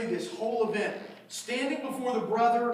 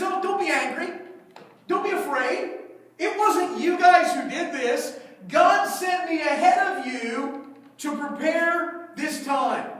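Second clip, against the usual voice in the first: mock soothing Joseph; 6 semitones higher, 5 dB louder, slower than usual. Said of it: "somehow in his twisted brain".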